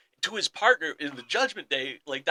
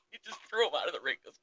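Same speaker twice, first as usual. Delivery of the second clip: ordinary talk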